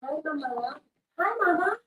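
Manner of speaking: very high squeaky pet voice; high cat voice. Pretend. The cat voiced greeting her owner, very high squeak.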